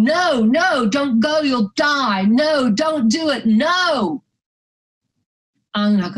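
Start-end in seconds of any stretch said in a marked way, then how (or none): 0.0-4.2 high, wailing warning cries; crowd calling out. Retold or imitated. Beach crowd begging her not to go in, imitated cries. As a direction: imitate onlookers calling someone back. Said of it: "I hear their voices saying".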